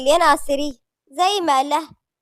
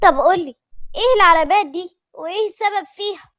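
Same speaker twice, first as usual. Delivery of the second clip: higher, anxious questioning; worried questioning; 5 semitones higher, 4 dB louder than usual. A narrator's reading. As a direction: press for an answer with worry. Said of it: "دعاء بقلق أكبر قالت". (أسئلة بقلق)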